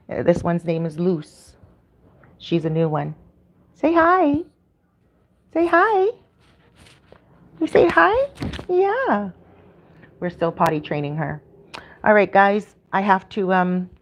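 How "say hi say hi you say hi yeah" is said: pet talk. Real, not acted. Owner coaxing her new dog to say hi, high pet talk. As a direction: coax the puppy in a much higher voice.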